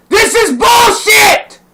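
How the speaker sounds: high, loud and slow, every word spat out; real furious yelling; furious slow shout. Real, not acted. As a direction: yell it at the top of your lungs. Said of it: "but I'm pissed off".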